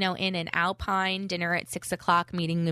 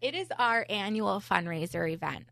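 normal talking voice

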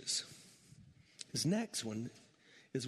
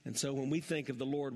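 normal talking voice

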